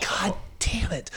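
whispered, slow and defeated. Retold or imitated. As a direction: whisper it to yourself, worn out. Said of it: "And I'm just like".

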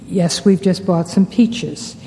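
ordinary delivery

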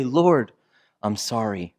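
hushed, falling apology to God; whisper-soft "I'm sorry" to heaven; quiet prayer. Retold or imitated. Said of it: "Turn up your eyes to heaven and say".

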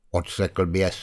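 ordinary delivery

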